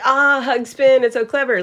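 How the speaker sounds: mock-impressed gush, pitched high and loud; gushing crowd imitation. Host mimics everybody admiring the DJ name, gushing.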